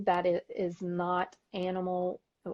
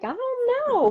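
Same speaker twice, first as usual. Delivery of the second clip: very high, louder protest; mock doubtful squeal; 5 dB louder, slower than usual. Wife answers husband's theory about aging, squeaky mock doubt. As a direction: shoot the pitch way up in playful doubt. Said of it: "And I'm like".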